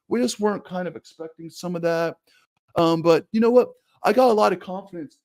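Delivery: hammed-up meek press conference voice; Ken Dorsey impression. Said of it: "Ken Dorsey talks more like this".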